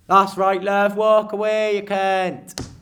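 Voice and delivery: very loud, slow taunting yell; jeering shout. Retold or imitated. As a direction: jeer her off down the street. Shouting after the woman.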